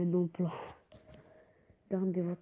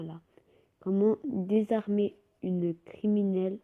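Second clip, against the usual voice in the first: ordinary talk, nothing marked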